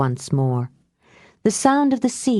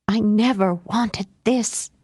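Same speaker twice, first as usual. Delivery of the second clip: slow, tight, held-down fury; bitter anger kept under the breath; furious whisper; 3 semitones higher than usual. A narrator's reading. Ripley to Mia, slow furious near-whisper. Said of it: "she said in a furious whisper".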